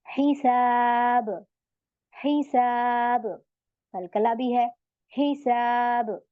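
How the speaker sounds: हिसाब drawn out very slowly, ending on a silent ब; slow tajweed stop on हिसाब; stilled ending. Shown as a demonstration. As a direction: recite हिसाब with the final ब held and echoing. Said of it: "आप इसको सुकून बनाएंगी".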